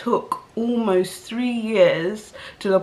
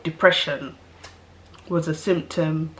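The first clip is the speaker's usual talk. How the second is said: ordinary talk, nothing marked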